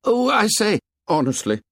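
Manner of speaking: high, proper English schoolboy huff; prim protest. A narrator's reading. Twins protest to the tribe, prim schoolboy indignation. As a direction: protest with wounded propriety.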